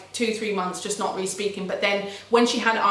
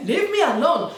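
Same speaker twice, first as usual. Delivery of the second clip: high, mocking outburst; mimicked Spanish girl; 7 semitones higher than usual. The Spanish girl's favourite thing to say, mocking imitation. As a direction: mimic her pet phrase, pitch jumping up. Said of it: "That's her favourite word to say".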